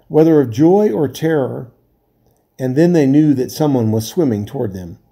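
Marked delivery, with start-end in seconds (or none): none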